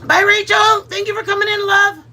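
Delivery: calling out goodbye. Real, not acted. Loud farewell to departing guest.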